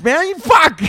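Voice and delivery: high, pinched mock rage at the manager; mock outburst. Frustrated customer to the manager, high mock snap. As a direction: squeal it like you've snapped.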